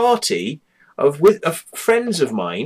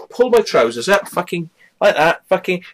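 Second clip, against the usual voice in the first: ordinary talk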